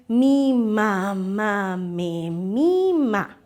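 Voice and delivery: very slow, swaying, each syllable stretched; rocking rhythmic reading. Shown as a demonstration. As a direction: rock the words like a rocking chair. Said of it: "hagan este movimiento y digan".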